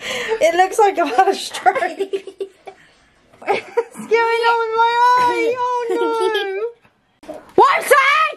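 helpless, high-pitched laughing fit; crying with laughter. Real, not acted. Telling viewers about the eye, crying with laughter. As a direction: squeal the words through real laughter. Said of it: "I'm actually crying".

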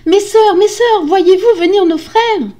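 high, loud, desperate call. A narrator's reading. Wife calling her sisters, desperate shout. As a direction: call anxiously to someone far away. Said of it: "Elle cria encore".

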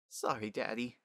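sheepish apology. Acted. Scolded daughter apologizes softly.

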